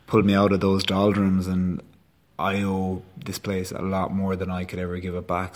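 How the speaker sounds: normal talking voice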